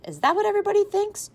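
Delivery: put-on mother asking her kids, high and incredulous. A narrator's reading. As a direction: soar up in disbelief.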